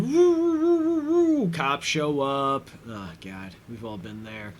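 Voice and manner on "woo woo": high wailing police siren woo; mock cop siren wail; siren imitation. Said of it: "we hear the woo".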